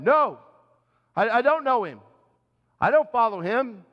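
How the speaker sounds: loud, flustered denial; panicked denial. Acted. Peter denying Jesus to the accusers, loud and flustered. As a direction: insist too hard, caught out.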